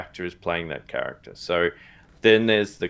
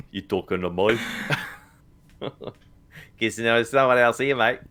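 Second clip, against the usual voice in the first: mock Australian accent; 3 semitones higher than usual. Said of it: "It's when you do moi".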